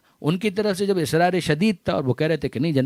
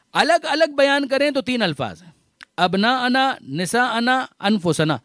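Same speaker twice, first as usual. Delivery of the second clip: rising sermon fervor; 5 semitones higher than usual. Acted. Preacher proclaiming the three names to the congregation, fervently. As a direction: call out the three words like a proclamation.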